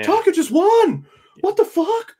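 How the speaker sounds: high, loud incredulous cry; mock shock. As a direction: replay the disbelief. Recalling shock at upset.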